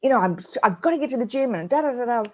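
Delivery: high, fast mock outburst; mother snapping at her son, a high fast mock scream; retold exasperated scream. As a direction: rattle it off high like losing it. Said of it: "just screamed at him".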